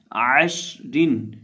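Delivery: عشرن with a throat ع and a drawn ending; correct pronunciation. Shown as a demonstration. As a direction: say عشرن slowly, no bounce on ش. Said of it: "تو اس کو پڑھیں گے".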